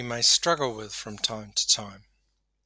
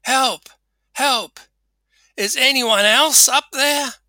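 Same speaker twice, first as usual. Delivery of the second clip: high, loud, drawn-out shout; yelling for help; 13 semitones higher, 8 dB louder than usual. Acted. Man on cliff seeks someone else to save him, yelling. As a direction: call past God for some other rescuer. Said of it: "Jack spoke again and yelled".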